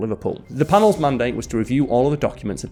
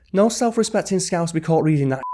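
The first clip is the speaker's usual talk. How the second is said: put-on a weak, half-hearted Scouse accent; a mild attempt at a Liverpool accent; bad Liverpudlian accent; 6 semitones higher than usual.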